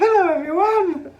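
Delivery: high, loud mock phone greeting. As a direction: imitate her posh phone hello. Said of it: "It's my phone voice".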